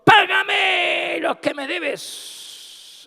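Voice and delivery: slow, high, loud shouted demand; furious demand. Acted. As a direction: choke the debtor as you yell. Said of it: "le ahogaba diciendo". (siervo furioso gritando lento y agudo)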